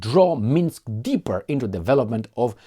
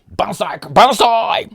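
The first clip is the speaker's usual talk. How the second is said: loud, much higher shout of banzai; battle cry; 12 semitones higher, 13 dB louder, much slower than usual. Real, not acted. Toasting the viewers with wine, loud banzai shout. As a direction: yell it like a charge.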